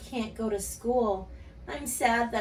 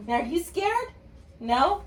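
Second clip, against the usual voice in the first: usual speaking voice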